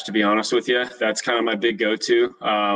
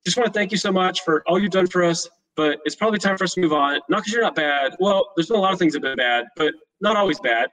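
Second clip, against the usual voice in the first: higher, nervous tumbling voicemail; awkward rambling voicemail; 7 semitones higher than usual. Retold or imitated. Host leaving cleaner an awkward firing voicemail, nervous and higher. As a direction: babble the firing message awkwardly. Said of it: "super awkward word vomit".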